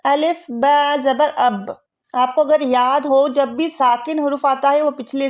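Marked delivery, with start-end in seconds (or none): 0.1-1.8 अलिफ ब ज़बर अब spelled out slowly; slow spelling out. Shown as a demonstration. Teacher recalling a reading lesson, spelling out slowly. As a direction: sound out the letters slowly. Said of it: "अब हमने पहले पढ़ा था".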